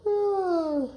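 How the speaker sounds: high, full ah of a stuffed belly; satisfied ah. Shown as a demonstration. Coach showing the fed wolf's sigh, high and pleased. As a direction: let out a contented ah after eating. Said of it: "Wolf, can you go".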